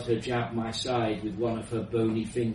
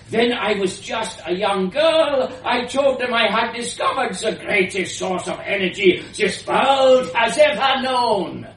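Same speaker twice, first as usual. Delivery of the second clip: high, loud rising rant; ranting shout; 10 semitones higher, 8 dB louder than usual. Acted. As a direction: build to a mad shout. Inventor rants about being mocked.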